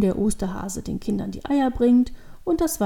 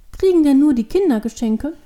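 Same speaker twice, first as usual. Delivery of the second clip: put-on higher, louder childlike question; curious child; 5 semitones higher, 6 dB louder than usual. A narrator's reading. Devil girl asks who gets presents, curious child. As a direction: ask eagerly like a little girl.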